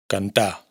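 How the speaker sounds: "Cantá" en catalán, sin la erre final. Shown as a demonstration. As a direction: acaba "Cantá" en la vocal acentuada. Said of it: "la R final no se pronuncia en los infinitivos". (infinitivo catalán sin erre)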